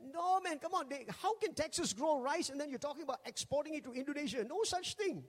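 imitating a buddy who won't believe it; incredulous friend. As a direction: scoff at a wild claim. Friend doubting Texas rice claim.

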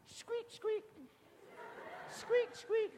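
tiny, high, soft mouse squeaks; mock mouse squeaks, soft and high; church mouse squeak. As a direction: squeak like a timid little mouse. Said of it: "like the church mouse".

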